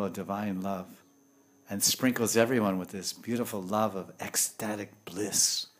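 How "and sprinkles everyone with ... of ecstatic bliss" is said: ordinary talk, nothing marked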